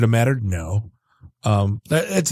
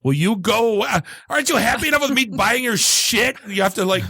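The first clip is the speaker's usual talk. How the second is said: pushy app voice; 8 semitones higher than usual. Retold or imitated.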